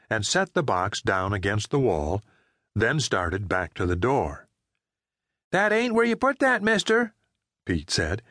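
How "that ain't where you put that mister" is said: put-on high little-boy voice, matter-of-fact; high child's voice telling off a stranger. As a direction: speak up like a small boy correcting a grown-up. Young Pete corrects the man.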